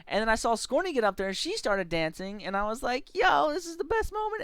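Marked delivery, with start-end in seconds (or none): 3.1-4.4 high-pitched giddy cheer; gleeful excitement. Acted. Player dancing beside teammate, gleeful excitement. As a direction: play up the giddy thrill. Acted glee while dancing together.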